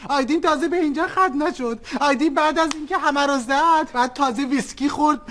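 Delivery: usual speaking voice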